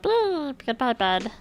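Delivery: a squeaky high beep for the puppy. Pretend. Telling friends what the puppy said, in a lightly squeaky voice. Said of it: "The puppy said".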